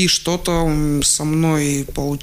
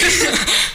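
bright burst of laughter, pitched up; high laugh; 12 semitones higher than usual. Real, not acted. Laughing as the host teases about composition, a high laugh. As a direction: laugh at being caught out.

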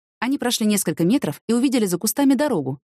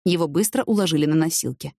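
usual speaking voice

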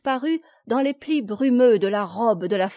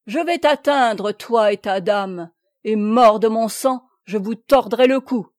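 loud, furious oath; yelled threat; 7 dB louder than usual. A narrator's reading. Guy de Plélan threatening Noël and his lady, yelled threat. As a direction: rage at him and swear revenge. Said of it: "hurla Guy de Plélan".

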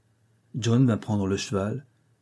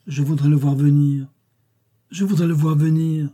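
higher, louder cry of longing; melancholy cry; 5 semitones higher, 5 dB louder than usual. A narrator's reading. Narrator voices Jane longing for his return, melancholy cry. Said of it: "m'écriai-je, saisi d'un accès de mélancolie".

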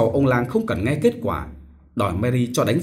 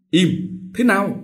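strained shout; 8 semitones higher than usual. A narrator's reading. Sick father strains to shout his sons silent. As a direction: force out a shout. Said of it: "Thừa cố thét ra tiếng".

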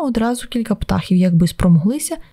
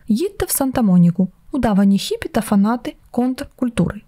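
ordinary delivery, nothing marked

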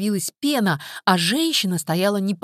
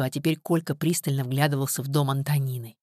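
usual speaking voice

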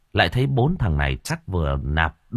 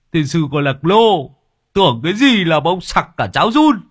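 higher, louder jeering voice; jeering character voice; 8 semitones higher, 7 dB louder than usual. A narrator's reading. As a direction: crow it out at Long. Character laughing jab.

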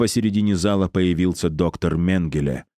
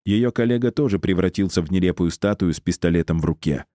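ordinary delivery

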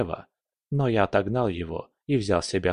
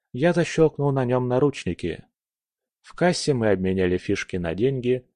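ordinary delivery

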